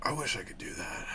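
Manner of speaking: whispered wistful aside; hushed, unvoiced confession. Real, not acted. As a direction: breathe it out under your breath. Wistful aside about his ex.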